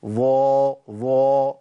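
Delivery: ظا with too much rounding, sounding like ظو; over-purse the lips on ظا; wrong demo, hint of u; overdone ظا. Shown as a demonstration. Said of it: "لو بلغت".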